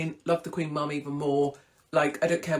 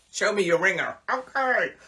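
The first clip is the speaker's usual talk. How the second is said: mock Hugh Edwards; 2 semitones higher, 4 dB louder than usual. Imagined voicing of Hugh.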